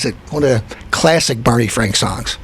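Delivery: usual speaking voice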